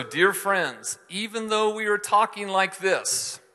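a pastor stressing the warning in the letter; earnest warning read. Retold or imitated. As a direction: read it like a stern heads-up. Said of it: "how is he talking?".

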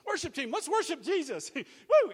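high, loud hype-man shout ending in a whoop; rally the worship team, loud and high; urgent rallying call. Acted. Preacher calling the worship team up so he can slip out, urgent rallying shout.

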